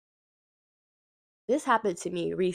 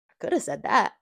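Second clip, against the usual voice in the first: a light breathy-pop star tone, lightly done. Shown as a demonstration. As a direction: slip into a playful pop-star voice.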